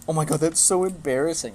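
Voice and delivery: hammed-up shocked teammates; mocking teammates. Mimicking rugby teammates to the speaker, mock gushing embarrassment. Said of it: "all of them were like".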